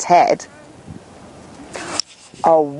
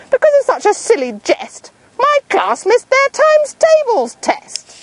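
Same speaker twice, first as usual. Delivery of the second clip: scolding teacher. Acted. Teacher scolding Nick about the test, huffy. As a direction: scold him over the missed test.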